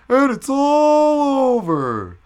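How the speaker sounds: high, drawn-out yell, hugely stretched on "all"; mock crowd shout. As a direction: holler it like a buddy yelling from the crowd. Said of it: "one of my jackass buddies from the crowd goes".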